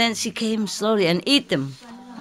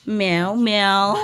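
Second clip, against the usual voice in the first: imitated meows. Shown as a demonstration.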